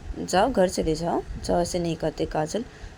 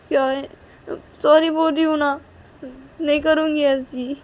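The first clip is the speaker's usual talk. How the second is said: put-on childish pleading; 7 semitones higher than usual. Kajal pleads with her mother.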